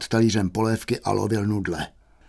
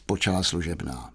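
plain reading voice

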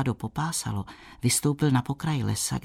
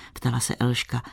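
ordinary delivery, nothing marked

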